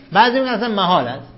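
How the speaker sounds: normal talking voice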